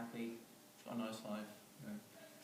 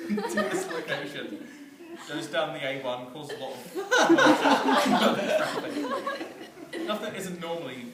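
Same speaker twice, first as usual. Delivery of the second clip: high, loud words broken by real laughter; can't keep it together, words spilling out through laughs; loud laughing through words; 5 semitones higher, 13 dB louder than usual. Real, not acted. Teller cracking up at his own story, laughing loudly through it.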